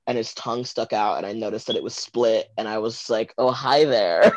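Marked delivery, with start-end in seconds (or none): 3.4-4.4 laughing. Retold or imitated. Flirty reaction to split tongue.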